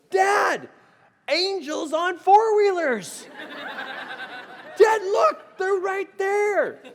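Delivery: put-on high, loud, laughing kid's yell, slowing down; excited child shout. Son yelling to his dad about the angels, high and loud. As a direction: shout it like a thrilled little boy. Son spots the four-wheelers.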